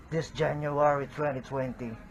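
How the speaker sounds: ordinary delivery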